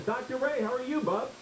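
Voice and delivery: ordinary talk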